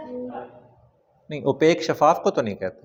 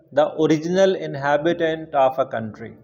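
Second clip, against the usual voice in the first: slow dictation; much slower than usual. Shown as a demonstration. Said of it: "slow dictation".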